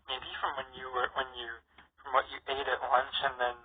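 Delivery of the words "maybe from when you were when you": ordinary talk, nothing marked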